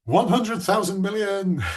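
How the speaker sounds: big excited slow shout-out; mock excited. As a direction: read her chat message like a thrilled fan. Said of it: "Sadie's like".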